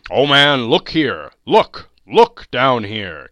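loud ringing exclamation; exclaiming. A narrator's reading. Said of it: "exclaimed the ghost".

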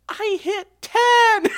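high, drawn-out yell breaking into a scream; overjoyed shout. Real, not acted. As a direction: shriek the big news with joy. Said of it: "I'm so happy".

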